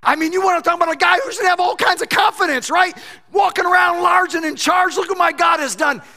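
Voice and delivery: loud, high, fast shouted preaching; preacher at full roar about swagger; full-force preaching. Acted. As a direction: blast it to the back row.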